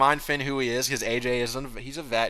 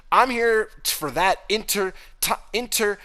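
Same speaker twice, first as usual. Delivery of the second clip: high, stumbling mock wrestler promo; fumbling promo voice; 8 semitones higher, 3 dB louder than usual. Retelling AJ's flubbed promo to the co-host, high and stumbling. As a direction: trip over the word in a higher voice.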